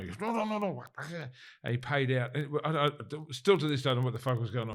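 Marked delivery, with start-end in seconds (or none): 0.2-0.8 much higher, rapid no's; mock hostile protest. The delegate rejecting his interpreter's words, mock hostile. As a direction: imitate a man cutting off his interpreter. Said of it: "but he was hostile".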